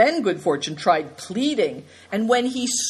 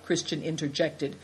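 ordinary delivery

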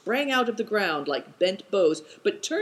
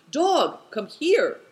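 high, drawn-out call to a dog; slow, raised-pitch shout; 9 semitones higher, 4 dB louder, slower than usual. A narrator's reading.

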